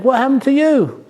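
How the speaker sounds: high, startled "what happened"; holy snake's shock. Acted. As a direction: cry out in concern at the bruises. Holy snake greets bruised snake.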